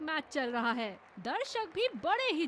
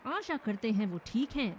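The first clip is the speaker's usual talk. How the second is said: normal talking voice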